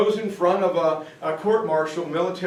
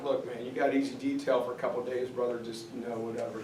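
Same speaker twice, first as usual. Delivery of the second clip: offhand mumble; 6 semitones lower, 11 dB quieter, faster than usual. Retold or imitated. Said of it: "and I'm like".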